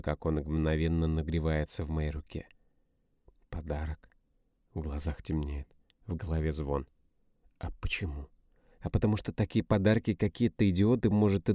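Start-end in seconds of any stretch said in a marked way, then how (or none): 3.5-4.1 whispered disbelief; shocked whisper; stunned whisper. A narrator's reading. Stunned at the gift. 7.6-8.3 half-whispered question; whispered aside. A narrator's reading. Narrator's bitter aside.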